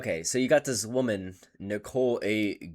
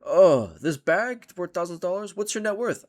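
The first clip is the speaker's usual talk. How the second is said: smug finance bro; 8 semitones higher, 6 dB louder than usual. Retold or imitated. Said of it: "And he's saying".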